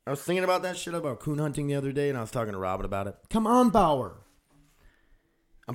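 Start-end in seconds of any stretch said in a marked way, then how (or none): 3.3-4.2 high, loud call at the dog; exasperated call to the dog; scolding the dog. Real, not acted. Calling to dog.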